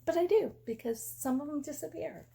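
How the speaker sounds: high, pleading protest. Retold or imitated. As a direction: plead your case, pitch rising. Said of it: "I'm like".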